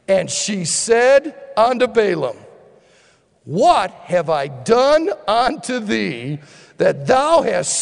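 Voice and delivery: slow, measured Bible reading; solemn scripture read. Acted. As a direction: read the verse slowly and deliberately. Reads the verse aloud.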